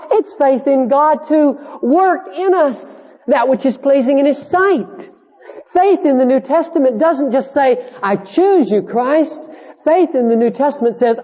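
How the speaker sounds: high-pitched, emphatic sermon delivery; impassioned preaching. Real, not acted. Preacher insists to congregation that faith rules out all boasting, impassioned. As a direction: preach it with rising conviction.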